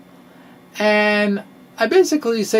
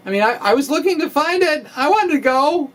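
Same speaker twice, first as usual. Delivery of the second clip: higher and fast, keen to be there; eager insistence; 9 semitones higher than usual. Real, not acted. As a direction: rush it out like you really wanted to go. Eager to attend the meeting.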